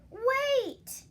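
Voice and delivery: loud high cry of wait; shouted warning. Acted. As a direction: yell to stop him.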